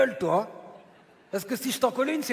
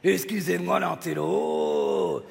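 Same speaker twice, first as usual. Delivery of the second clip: low, very drawn-out sarcastic oohing; mocking awe; 6 semitones lower, much slower than usual. Puppet mocking the intellectual partner, drawn out and sarcastic. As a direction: stretch it low in fake apology.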